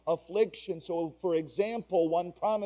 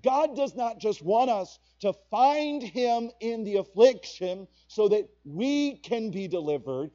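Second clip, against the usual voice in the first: ordinary delivery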